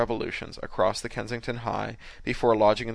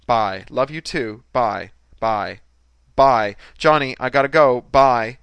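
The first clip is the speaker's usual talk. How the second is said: loud, punchy goodbyes; brisk phone sign-off; 2 semitones higher, 10 dB louder, slower than usual. A narrator's reading. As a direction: wind up the call emphatically. Woman signs off a call.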